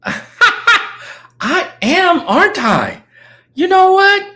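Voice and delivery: laughing exclamation. Real, not acted. Laughs at own joke.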